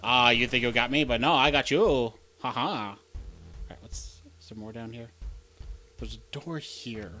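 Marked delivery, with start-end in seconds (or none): none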